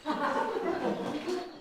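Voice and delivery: a high airy laugh at the bird; tree's high laugh; high mocking laugh. Tree laughing at the turned-away bird, high and airy. Laugh after the birch refuses.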